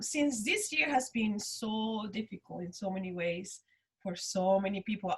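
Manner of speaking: normal talking voice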